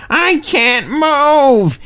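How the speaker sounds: high, very slow whine; high drawn-out complaint; complaining. A narrator's reading. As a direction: drag it out in a stuck whine. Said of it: "he complained".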